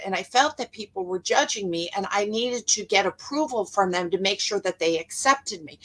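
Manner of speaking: ordinary talk, nothing marked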